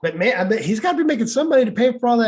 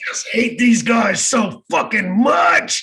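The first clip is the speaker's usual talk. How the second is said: mock rant; 3 semitones higher than usual. Skit rant.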